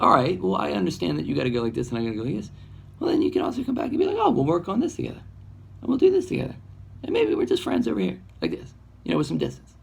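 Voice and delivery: sweet, fast, bouncy peacemaking; playful high falsetto of friendly souls; cheery reconciliation. Retold or imitated. Voicing amicable parting souls.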